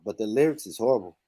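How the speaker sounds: normal talking voice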